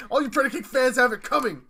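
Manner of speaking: loud hammed-up taunt; gloating taunt. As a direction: jeer at the fans, loud. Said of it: "going like".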